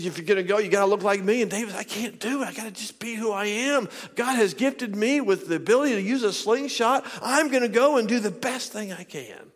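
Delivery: high, driving sermon storytelling; impassioned preaching. Retold or imitated. Preacher acting out David refusing Saul's armor, impassioned preaching. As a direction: retell it with mounting preacher's fervour.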